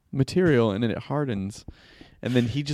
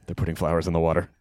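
low fast conspiracy voice; 11 semitones lower, faster than usual. Acted. Repeat, faster low voice.

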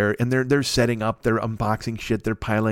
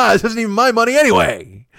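loud, high, amused outburst; real laugh breaking into raised voice; 9 semitones higher, 5 dB louder than usual. Real, not acted. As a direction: laugh and crow it out. Laughing at his own spending.

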